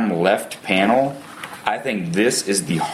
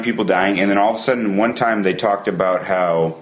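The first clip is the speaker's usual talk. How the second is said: ordinary delivery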